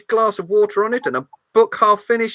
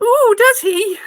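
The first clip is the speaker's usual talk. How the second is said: put-on high, amused old lady; old granny voice; 13 semitones higher, 4 dB louder, slower than usual. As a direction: coo it like a granny. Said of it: "my old granny voice".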